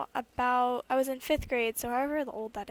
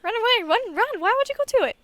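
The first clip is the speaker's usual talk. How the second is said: much higher, louder, fast mock panic; freaking out; 10 semitones higher, 9 dB louder than usual. Scared kid imagining the doctors fixing her arm, frantic high squeal. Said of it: "I was freaking out at this point".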